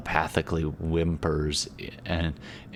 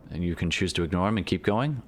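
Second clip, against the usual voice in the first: normal talking voice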